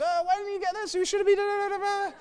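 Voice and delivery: high, squeaky nagging voice; mock scolding family member. Family blaming each other when late, high mock nagging. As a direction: nag in a high squeaky put-on voice. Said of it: "And then there's arguments".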